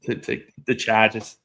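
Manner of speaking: teasing Boston accent imitation; mock Boston, dropping the r. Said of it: "my Boston accent showing through".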